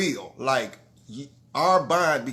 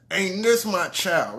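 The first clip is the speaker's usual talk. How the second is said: normal talking voice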